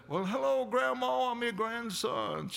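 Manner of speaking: high, slow sugary fake grandson; mock sweet scammer voice; con man on the phone. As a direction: put on a syrupy caller voice to fool grandma.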